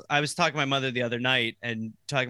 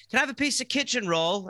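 a little higher, lightly English; lightly English request; British phrasing; 7 semitones higher than usual. Retold or imitated. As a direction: ask innocently, lightly English. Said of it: "I'd be like".

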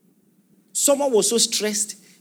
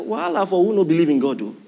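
Nigerian Pidgin exclamation; faster than usual. Retold or imitated. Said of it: "I said".

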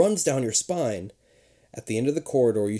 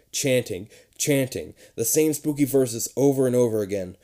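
ordinary delivery, nothing marked

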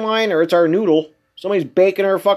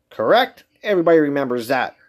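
ordinary talk

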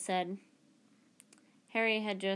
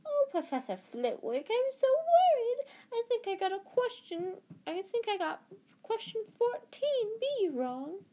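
mock a fretting girl, pitched way up; mock high voice; 14 semitones higher, 4 dB louder than usual. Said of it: "he put in a high voice".

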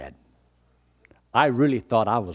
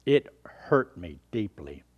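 normal talking voice

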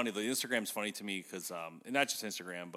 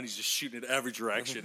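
ordinary talk, nothing marked

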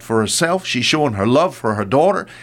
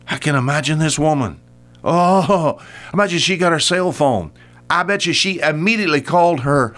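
usual speaking voice

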